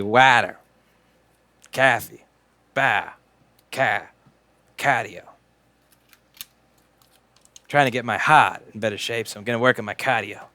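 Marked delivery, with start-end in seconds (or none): 0.0-5.2 broad Boston vowels, dropped r's; exaggerated Boston accent, word by word; mock Boston accent. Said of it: "they say things funnily".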